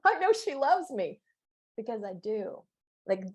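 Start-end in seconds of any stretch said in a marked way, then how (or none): 0.0-1.2 laughing quote. Retold or imitated. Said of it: "and you're like".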